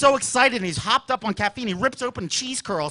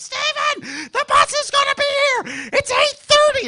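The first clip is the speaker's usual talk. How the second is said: high shrill wake-up call from mom; mom yelling; 4 dB louder, slower than usual. Retold or imitated. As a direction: mom calling up the stairs. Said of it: "She'd be like".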